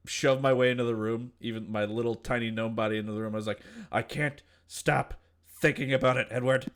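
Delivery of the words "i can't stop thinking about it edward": loud, raised, insistent plea; obsessed outburst. Acted. Gnome shoving into Edward's room, obsessed and urgent. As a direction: push each phrase out loudly and urgently.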